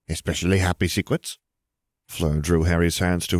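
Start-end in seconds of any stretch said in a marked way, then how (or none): 0.1-1.4 perky, teasing lilt; chirped. A narrator's reading. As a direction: chirp it with a lift at the end. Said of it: "Gabby chirped".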